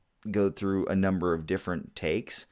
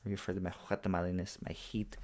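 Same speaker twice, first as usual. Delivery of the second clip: put-on Agador accent; 9 dB quieter, faster than usual. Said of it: "an accent that's very much like Agador".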